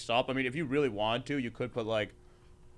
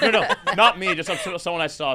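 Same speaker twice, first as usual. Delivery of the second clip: laughing denial; 4 semitones higher, 9 dB louder than usual. Real, not acted. Laughing off suspicion of stealing.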